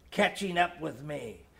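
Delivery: plain reading voice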